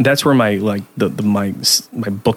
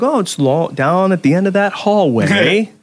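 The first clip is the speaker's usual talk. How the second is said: higher, drawn-out retold reply; imitated host's answer; 7 semitones higher than usual. Host re-enacts directing the guest down the hallway, imitated answer. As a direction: give slow directions in a raised, put-on voice. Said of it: "And we're like".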